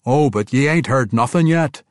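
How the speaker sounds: loud knowing dwarf promise; assuring dwarf. Acted. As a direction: tease them with what's coming. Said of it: "King Conrad assured him".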